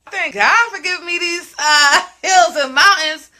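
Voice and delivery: playful, giggling thanks; laughing. Real, not acted. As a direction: laugh as you brag about your curves. Joking about her curves.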